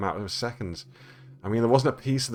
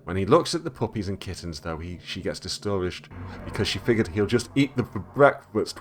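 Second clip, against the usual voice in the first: usual speaking voice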